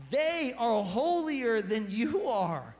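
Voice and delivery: slow, punched scolding; slow, emphatic rebuke. Retold or imitated. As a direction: hammer home that pagans are holier. Paul rebukes the church.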